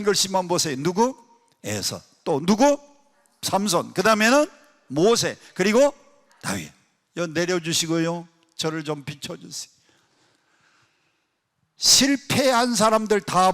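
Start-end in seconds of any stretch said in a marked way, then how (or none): none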